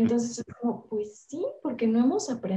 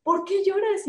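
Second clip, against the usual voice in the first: much higher, irritated mother's question; annoyed; 11 semitones higher than usual. Retold or imitated. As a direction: push the question up high and annoyed. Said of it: "así como".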